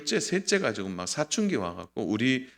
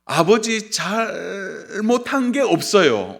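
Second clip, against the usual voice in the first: drawn-out, higher, louder mock protest; stubborn denial; 7 semitones higher, 5 dB louder, much slower than usual. Child denying any fault to father, stubbornly. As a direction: play a child refusing to admit fault, stretching it out. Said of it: "그런데 끝까지 얘기 안 해요".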